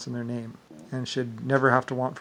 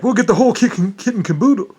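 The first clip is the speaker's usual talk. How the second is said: high, louder mock voice, smug; imitating Rick Bush; 8 semitones higher, 8 dB louder than usual. Mocking Rick Bush grabbing everything, high smug voice. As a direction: ham up a greedy schemer. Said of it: "Rick Bush was like".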